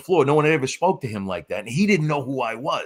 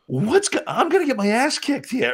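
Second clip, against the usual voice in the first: mock panic; 12 semitones higher than usual. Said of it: "I'm looking at Kyle and Matt going".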